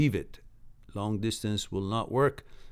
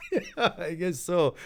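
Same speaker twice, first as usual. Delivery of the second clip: 1 laughing; 6 semitones higher, slower than usual. Real, not acted. Laughing at chat joke.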